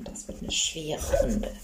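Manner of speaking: slow whispered repeat; whispering. Pretend. Repeats line, then whispers it.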